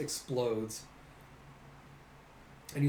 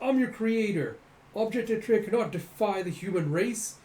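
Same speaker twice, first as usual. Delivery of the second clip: higher, louder frantic raving; frantic rant; 6 semitones higher, 3 dB louder than usual. Acted. Creator raving at his crawling body parts, high and frantic. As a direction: shriek orders at your creations.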